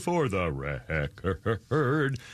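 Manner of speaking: very slow, low, wavering "record"; drawn-out emphasis. Pretend. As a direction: stretch "record" out into a long, low wobble. Mock-solemn repeat of the phrase.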